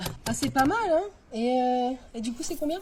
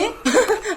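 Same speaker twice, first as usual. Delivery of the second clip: short laugh, higher; embarrassed laugh; 7 semitones higher, 4 dB louder than usual. Real, not acted. Teacher laughing at the mix-up, short laugh.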